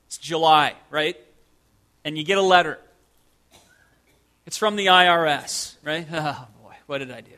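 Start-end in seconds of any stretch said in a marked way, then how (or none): 6.1-7.3 quiet, low, sinking dread; hushed acted oh-no. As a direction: mutter it as if caught in a mistake. Acts dread at IRS letter.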